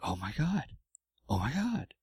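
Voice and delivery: half-whispered amazement at the news; stunned husband, quiet and awed; stunned disbelief. Retold or imitated. Husband realizing wife is pregnant.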